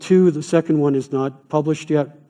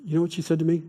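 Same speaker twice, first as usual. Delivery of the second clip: normal talking voice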